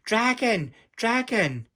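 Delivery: high, loud alarm cry; girl calling for help as a real dragon comes. A narrator's reading. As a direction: shout for help from the hill. Alarm call from the hill.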